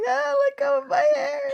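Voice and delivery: high, wailing fake sob; mock crying. Boy whose hair is being ripped, mock crying. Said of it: "Him crying, going".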